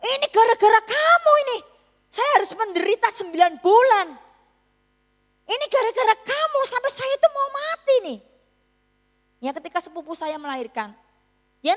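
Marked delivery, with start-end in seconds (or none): 0.0-8.2 loud, high, hammed-up scolding; playacted outburst of accusation, pitched high; mock angry mother. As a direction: blame the baby in a raised voice. Acted mother blaming her newborn.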